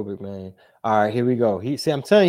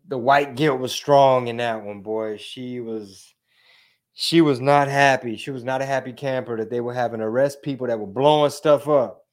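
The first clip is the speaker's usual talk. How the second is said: normal talking voice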